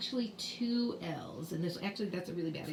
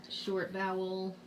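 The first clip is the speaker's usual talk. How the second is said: ordinary talk, nothing marked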